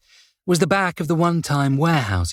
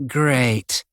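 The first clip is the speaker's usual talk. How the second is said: dry, displeased; grudging and flat; unhappy. A narrator's reading. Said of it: "Angley's voice wasn't happy about that".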